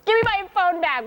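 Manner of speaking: high-pitched imitation of a child's tantrum; mock childish outburst; stomping five-year-old. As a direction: demand it like a stomping little kid. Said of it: "stomping like a five-year-old child".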